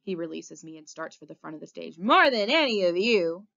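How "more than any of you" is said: loud, drawn taunt at a crowd; shouting. A narrator's reading. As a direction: shout down the whole audience. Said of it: "he shouts".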